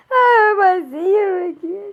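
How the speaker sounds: loud tearful cry; sobbing out a hope to see him again. Real, not acted. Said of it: "I'm gonna try not to cry anymore".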